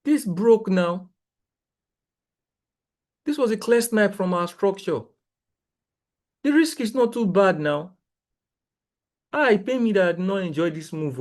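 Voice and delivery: high, pained disbelief at the missed move; exasperated. Real, not acted. Trader to his viewers, exasperated and high. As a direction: groan it out, a clear snipe got away. Trader upset gold moved without him.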